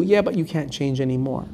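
soft, low, final answer to a plea; quiet refusal. Acted. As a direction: shut the door gently but finally.

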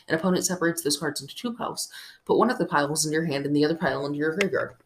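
rushed, fast read. Real, not acted. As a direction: rattle off the card text quickly. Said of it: "Well, you say that quickly".

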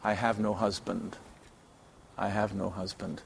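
hushed shameful confession; ashamed. Acted. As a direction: admit it quietly, eyes down. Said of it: "probably with some shame".

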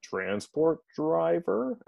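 slow, drawn-out guess rising high at the end; imitated unsure answer. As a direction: answer as if asking whether it's right. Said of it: "like a fish out of water".

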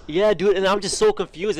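ordinary talk

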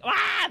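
high startled scream; sudden high shriek of fright; retold scream. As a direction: yelp as the rope drops. Rappelling drop scream.

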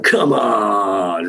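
long drawn-out urging cry; imitated cheering-on. Friend in front row urging performer, drawn-out cheer. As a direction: stretch it out like egging someone on. Retold friend egging on from audience.

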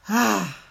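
big open sigh, letting the shoulders drop; loud audible sigh. Shown as a demonstration. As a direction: release it all in one loud exhale. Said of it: "drop them on the out breath with a loud, audible sigh".

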